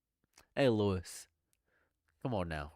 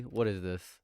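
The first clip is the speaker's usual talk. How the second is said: usual speaking voice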